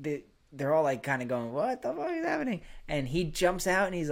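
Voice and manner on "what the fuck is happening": high-pitched mock disbelief; imitated bewilderment. Buddies reacting to the stripper's stunt, mock bewilderment. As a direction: voice the baffled onlookers in a high pitch.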